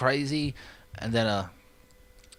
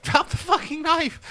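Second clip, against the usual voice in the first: even higher shrieked command with a laugh; mock police yell, laughing; 5 dB louder than usual. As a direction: scream it, losing patience, laughing. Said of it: "that police are like".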